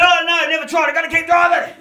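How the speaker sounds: high shouted answer; loud truckie punchline. Retold or imitated. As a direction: bark it out like an exhausted truckie.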